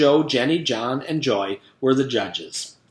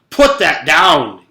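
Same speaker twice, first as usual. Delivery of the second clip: loud, slow, furious command; angry yell; 3 semitones higher, 11 dB louder, slower than usual. A narrator's reading. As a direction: bark it at the child who touched the notebook. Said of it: "yelled Missus Drizzle".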